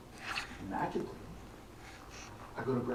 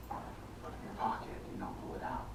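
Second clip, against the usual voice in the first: usual speaking voice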